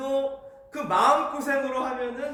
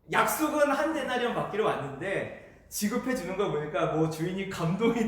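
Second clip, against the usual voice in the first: ordinary talk, nothing marked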